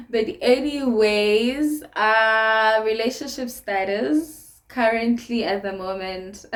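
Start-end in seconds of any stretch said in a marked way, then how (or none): none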